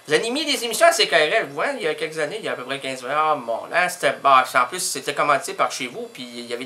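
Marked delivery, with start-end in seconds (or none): none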